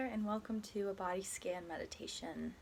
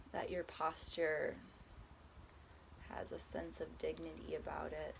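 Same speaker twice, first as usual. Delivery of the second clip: calm, unhurried body scan guidance with long pauses; guided meditation; 4 dB quieter than usual. Acted. Guiding a listener through a body scan, soft meditation voice. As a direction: ease the listener gently from one body part to the next.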